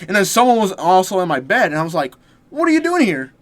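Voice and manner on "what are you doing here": high-pitched mock surprise; retold startled question, pitched way up. Finding someone in the bed in a dream, mock startled.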